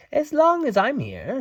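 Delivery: hint of a cheerful fairy; lightly voiced Tink. A narrator's reading. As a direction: think aloud brightly. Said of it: "she said to herself".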